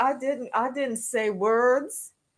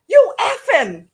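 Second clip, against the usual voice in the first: higher, louder clipped curse at a passing car; hammed-up angry yell of a half-bleeped curse; imitating a driver cussing someone out, mock yell; 7 semitones higher, 6 dB louder than usual. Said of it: "they'll go".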